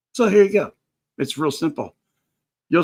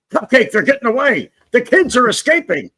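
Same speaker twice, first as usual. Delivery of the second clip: raised, higher urgent call to Cupcake; 7 semitones higher, 6 dB louder than usual. Retold or imitated. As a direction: call out that the kids are escaping. Retelling calling out to Cupcake.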